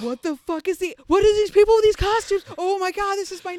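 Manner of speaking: high, loud shocked outburst. Retold or imitated. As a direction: blurt it out in horrified disbelief. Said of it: "My shocked noise just then was".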